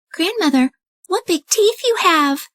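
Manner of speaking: put-on high wondering girl; puzzled young girl; girl marvelling at grandmother's arms; high little girl voice. Red questions the disguised wolf.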